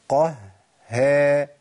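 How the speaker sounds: "قه" dark, then "ها" thin with a slight smile; heavy qaf, light ha. Shown as a demonstration. Teacher breaks the word into steps, light ha. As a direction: move from pouted "قه" to a light, smiling "ها". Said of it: "في حالة ابتسامة بسيطة قوي".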